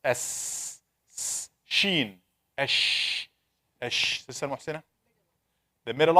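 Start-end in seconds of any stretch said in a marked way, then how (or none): 0.1-4.2 Ash hissed softly, no voice; hissed letter sounds. Shown as a demonstration. Teacher models the شين sound quietly. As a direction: breathe out Ash with no voice. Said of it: "the tip of the tongue".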